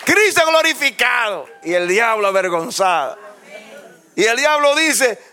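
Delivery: high, loud preacher's cry; fiery preaching. Acted. Pastor rouses the congregation against the devil, loud high preaching. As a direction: preach it to the back row.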